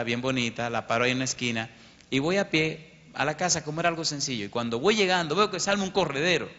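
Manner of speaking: ordinary talk, nothing marked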